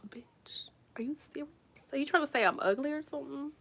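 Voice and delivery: hushed, disbelieving aside; near-whispered acted offence; quietly offended. Patient reacting to the doctor's question, quietly offended.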